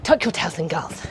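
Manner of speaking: lightly voiced bossy ballet teacher; a hint of the stern old teacher correcting girls. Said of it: "and be like".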